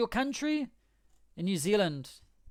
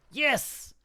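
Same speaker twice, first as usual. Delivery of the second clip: excited yes; 7 semitones higher than usual. Real, not acted. Tongue-twister take finally works.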